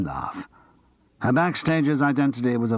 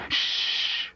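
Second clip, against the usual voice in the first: sharp unvoiced shush; dramatic loud shushing hiss; 4 dB quieter than usual. A narrator's reading. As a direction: hush him urgently. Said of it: "Clive hissed loudly".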